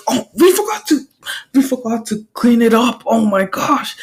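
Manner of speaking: high-pitched dismay; panicked realisation. Acted. Hero realising they forgot the villain, panicked. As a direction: wail it in mock panic.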